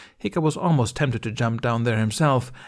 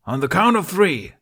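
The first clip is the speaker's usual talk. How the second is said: high, loud shout; yelling; 8 semitones higher, 6 dB louder than usual. Acted. Toughnut yelling the start of the joust. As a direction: shout it across the arena. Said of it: "Toughnut yelled".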